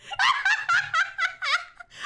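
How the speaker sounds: big burst of laughter; loud laugh. Real, not acted. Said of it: "I'm laughing because".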